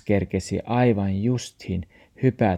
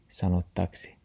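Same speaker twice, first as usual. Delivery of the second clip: ordinary delivery, nothing marked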